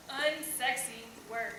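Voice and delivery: ordinary talk, nothing marked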